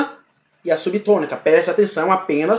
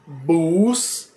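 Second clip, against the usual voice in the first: "Buscapé" stretched very slowly, each syllable held; slow, drawn-out word; much slower than usual. Shown as a demonstration. Teacher presenting an example word to students, very slow. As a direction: let "Buscapé" unfold slowly for dictation. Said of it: "Eu usei exemplos aí".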